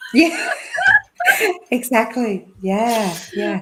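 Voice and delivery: loud burst of laughter; laughing. Real, not acted. Laughing at the host's joke about water. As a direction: laugh out high and free.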